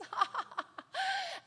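light, high giggle; high soft giggle. Real, not acted. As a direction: giggle softly with delight. Joyful laughter in prayer.

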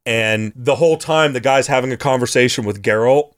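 ordinary talk, nothing marked

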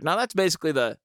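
ordinary delivery

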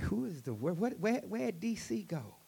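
high, bewildered mock voice; baffled listeners. Imitating listeners lost by his Cajun talk, high and baffled. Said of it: "And they'd be like".

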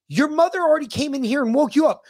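high, fast, loud mock yell at the son; exasperated dad yelling the kid awake; retold scolding yell. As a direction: snap it out like a fed-up dad rousing his boy. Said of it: "I was just like".

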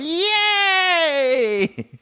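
very high, laughing squeal of joy; triumphant cheer. Retold or imitated. Walking out of the store with the email, a high laughing cheer. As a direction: squeal it like you won.

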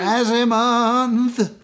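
high, slow, drawn-out echo; excited echo. Acted. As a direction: shout it back like a game show host. Echoing the announcement.

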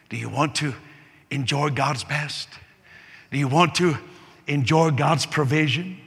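normal talking voice

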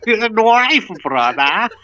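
hammed-up Aussie brandishing a knife; mock Australian accent. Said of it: "That's two accents".

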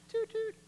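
high-pitched "toot toot"; playful train-whistle toot; high horn toot. Acted. Tooting own horn.

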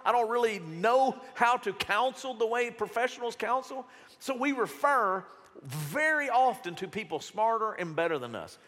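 normal talking voice

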